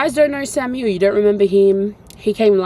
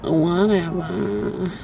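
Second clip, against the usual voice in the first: slow, drawn-out sickly groan of a word; hammed-up feeling unwell; 5 semitones lower, 4 dB quieter, much slower than usual. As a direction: drag it out like you feel rotten. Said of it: "That's how I've been feeling".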